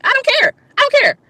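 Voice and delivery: high-pitched, indignant dismissal; worked-up, high defiant protest; defiant retort. Real, not acted. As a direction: fire it back high, brushing off the critic. Retorts to being called dramatic.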